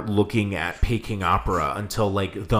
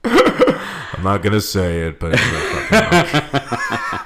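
slow, louder, words shaking with laughter; laughing through words; 6 dB louder, slower than usual. Real, not acted. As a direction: get it out while still laughing. Laughing echo of cohost's line.

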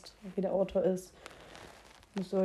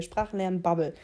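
usual speaking voice

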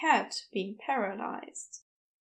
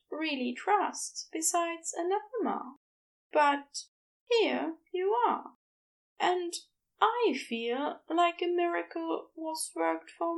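higher, tender Aziraphale; higher Aziraphale telling Crowley he trusts him; 6 semitones higher than usual. A narrator's reading. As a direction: lift the voice and let it glow with affection.

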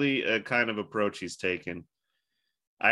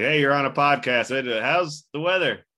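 calling out the window; 6 semitones higher, 5 dB louder than usual. Pretend. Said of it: "be like".

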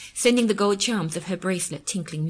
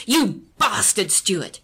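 slow, louder choked insult; choked fury; 6 dB louder, much slower than usual. A narrator's reading. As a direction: force the words out through rage. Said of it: "choking out the words".

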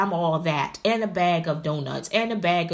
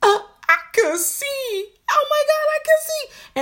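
high, louder thrilled cry of wonder; giddy amazement; 5 dB louder, slower than usual. Retold or imitated. A student trying new contact lenses, high thrilled wonder. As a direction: gasp out the joy of seeing clearly. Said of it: "I was like".

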